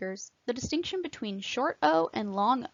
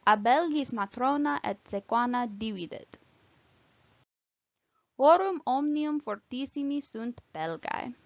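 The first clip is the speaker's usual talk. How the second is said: Latin practice reading. Shown as a demonstration. Teacher reading Caesar for students to repeat, careful Latin recitation. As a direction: read it out phrase by phrase, measured and precise. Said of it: "I will read a few sentences".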